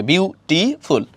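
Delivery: বিউটিফুল split slowly into bew, tee, ful; three-syllable pronunciation. Shown as a demonstration. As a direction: say বিউটিফুল in three separate beats. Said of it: "তিনবারে উচ্চারণ করে".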